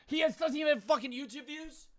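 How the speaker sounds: high, fast complaint; high, rushed disbelief; indignant protest. Real, not acted. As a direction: argue rapidly in a high pitch. Still protesting the item.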